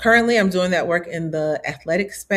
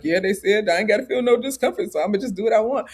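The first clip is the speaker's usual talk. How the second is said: mock careless voice; 4 semitones higher, faster than usual. Imitating a self-centered person.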